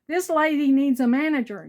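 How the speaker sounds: calling out to the far end of the store; retold yell. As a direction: clerk announcing it to the whole store. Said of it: "he yells across the store".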